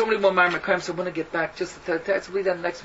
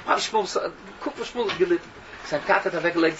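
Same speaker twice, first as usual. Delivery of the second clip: usual speaking voice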